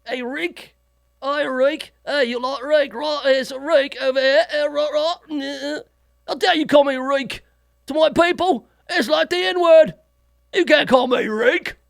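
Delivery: high, hammed-up English accent; mock English voice, growing indignant. English guy protests being called Reek, in mock English accent. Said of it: "you'd call an English guy Reek".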